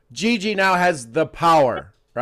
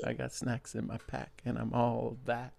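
much softer, almost under breath; soft playful rhyme; 4 semitones lower, 16 dB quieter than usual. Shown as a demonstration. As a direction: drop the voice and toss off the rhyme softly. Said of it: "second take, soft".

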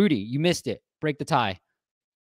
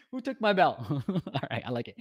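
ordinary delivery

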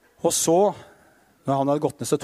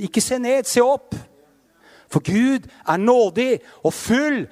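slow, higher and louder appeal; fervent preaching; 5 semitones higher, 4 dB louder than usual. Acted. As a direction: lift them up with fervour. Urgent exhortation to fallen believers. (inderlig forkynnelse, langsom og kraftfull)

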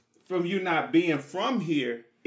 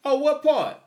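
mock questioner; 10 semitones higher, 5 dB louder, slower than usual. Acted Houston exchange.

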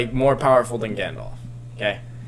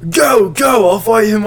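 urgent raised call to flee; retold shout; 7 semitones higher, 7 dB louder than usual. Wizard shouting at his friends to run, urgent. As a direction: shout it to send them off.

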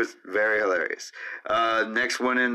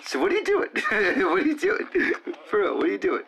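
very high, giggly questioning; playful cat talk; 10 semitones higher, faster than usual. Pretend. Teasing the cat in a bit, high-pitched. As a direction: squeal the question up high with a laugh.

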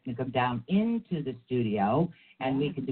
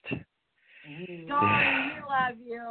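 warm shout-out; 10 semitones higher than usual. Real, not acted. Affectionate shout-out to Tony.

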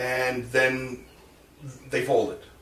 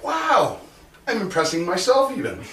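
mock amazement; 8 semitones higher than usual. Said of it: "I'm like".

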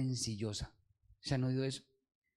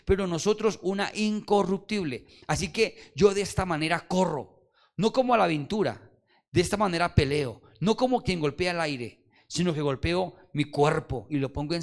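louder, declamatory Bible reading; preaching scripture; 3 semitones higher, 5 dB louder than usual. Acted. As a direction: proclaim the verses to the church.

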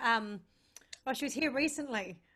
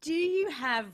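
laughing; 6 semitones higher, 6 dB louder than usual. Real, not acted.

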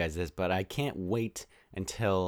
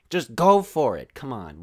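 high, loud, exasperated egging-on; sarcastic urging; 4 semitones higher, 8 dB louder than usual. Acted. Podcaster goading the sword-wielding enemy, sarcastic shout. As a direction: egg them on at the top of your voice.

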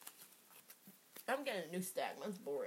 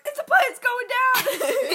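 high, slow panicked cry; panicked passenger; 10 dB louder, much slower than usual. Pretend. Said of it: "They're like".

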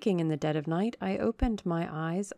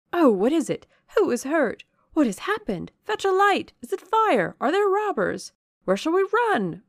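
high, loud, alarmed questions tumbling out; a frightened crowd, each cry higher pitched; confused, panicked demands; 11 semitones higher, 7 dB louder than usual. A narrator's reading. Roused guests crowding the dark gallery, confused alarm. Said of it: "was demanded confusedly on all hands".

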